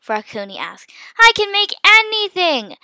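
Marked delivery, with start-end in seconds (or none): 1.2-2.8 high, loud brag; boastful exclamation. A narrator's reading. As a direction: show off your powers loudly. Villain brags of his powers.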